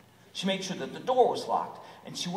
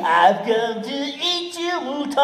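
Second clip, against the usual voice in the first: loud, sing-song wolf voice; big bad wolf; 8 semitones higher, 11 dB louder, much slower than usual. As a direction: taunt the sheep like a hungry wolf. Wolf calls to the sheep.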